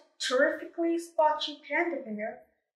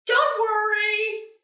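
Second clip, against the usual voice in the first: calling out; 8 semitones higher, 4 dB louder, slower than usual. Acted. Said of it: "the panda bear called".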